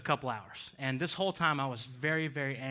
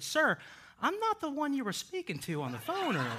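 higher, laughing as the other lady; a lightly voiced, amused stranger correcting him; bemused woman; 13 semitones higher, 4 dB louder than usual. Other lady's surprised correction.

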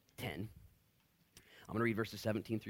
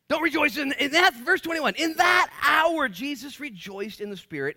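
proclaimed verse; 14 dB louder than usual. Acted. Preaching at full force.

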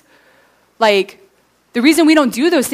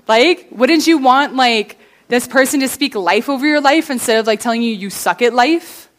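ordinary talk

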